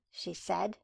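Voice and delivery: usual speaking voice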